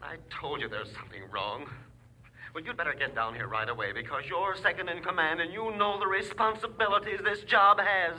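agitated complaint. Acted. Worked-up caller complains to his superior.

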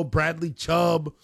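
ordinary talk

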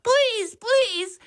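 high frantic begging; a frightened lady pleading from the bench; panicked pleading. A narrator's reading. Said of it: "standing on the piano bench screaming".

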